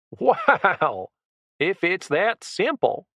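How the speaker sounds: high, slow sarcastic scoff; mock the offer; mocking disbelief. A narrator's reading. Ringleader sarcastically scoffing at the captive's offer.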